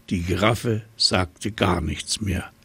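plain reading voice

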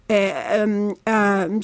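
hesitant äh and ähm, searching for words; stammering. Acted. Stulle stammers when the aunt asks how he knows. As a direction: stall for time, caught out. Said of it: "stotterte Stulle".

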